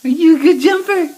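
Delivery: high loud praise. Real, not acted. Parent praises the jumping baby, high and loud.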